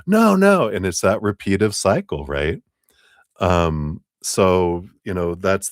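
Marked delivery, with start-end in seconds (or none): 0.1-0.7 high loud no no; mock panic. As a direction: recoil in hammed-up panic. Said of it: "you're like".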